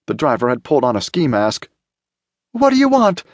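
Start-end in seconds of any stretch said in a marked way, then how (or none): 2.5-3.2 very high-pitched frantic demand; high, urgent cry; frightened shout. A narrator's reading. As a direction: shout at the abductor in panic. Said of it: "T J shouted".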